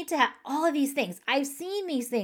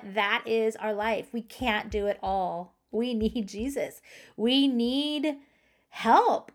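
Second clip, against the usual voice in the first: normal talking voice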